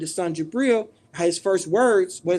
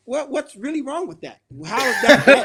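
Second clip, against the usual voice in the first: higher, building to a loud laughing protest; mock protest; 5 semitones higher, 6 dB louder than usual. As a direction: play innocent, then get louder. Mock protest against a mocking accusation.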